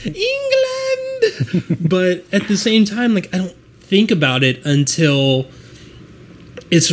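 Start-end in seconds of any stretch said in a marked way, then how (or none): 0.0-1.9 mock scream. American fan on how obviously British it is, high mock scream. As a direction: screech it out in jest, far higher than usual. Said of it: "everything about it just screams".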